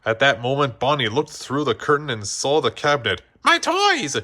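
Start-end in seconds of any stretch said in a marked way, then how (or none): 3.4-4.2 very high, loud delighted cry; excited cry. A narrator's reading. Bonnie crying out on seeing her toys. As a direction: cry out like a thrilled little girl. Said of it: "she cried".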